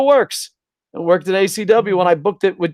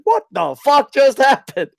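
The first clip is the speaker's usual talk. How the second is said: higher, louder, breaking into a laugh; mock disbelief yell; shocked outburst; 9 semitones higher, 4 dB louder than usual. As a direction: blurt it out again, laughing. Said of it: "I was like".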